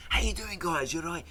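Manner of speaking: put-on high, chirpy South African waiter accent; South African accent, pitched well up; imitated South African accent. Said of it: "That's a good accent".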